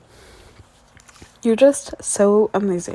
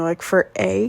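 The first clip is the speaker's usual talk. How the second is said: ordinary talk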